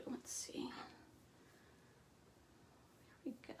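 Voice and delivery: whispered under her breath; a quick private whisper while busy; whispered aside. Real, not acted. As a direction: mutter it to yourself in a whisper. Muttering to herself while working.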